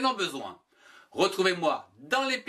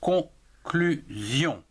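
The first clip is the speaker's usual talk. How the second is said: "conclusion" drawn out very slowly as con-clu-sion, each of the three syllables separated; slow, deliberate split of "conclusion" into three beats; much slower than usual. Shown as a demonstration. Teacher showing learners the syllables of "conclusion", slowly and clearly. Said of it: "trois syllabes".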